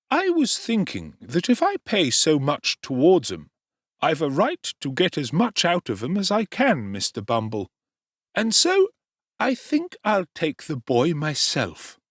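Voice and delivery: high ingratiating tradesman; oily undertaker. Acted. Undertaker voiced in story.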